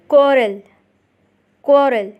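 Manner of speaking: normal talking voice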